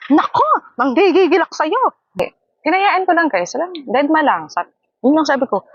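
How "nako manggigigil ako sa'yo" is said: mock exasperation. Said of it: "gigil na gigil".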